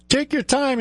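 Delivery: high, lazy cocky hare. Acted. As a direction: toss it off like a smug hare. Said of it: "he said".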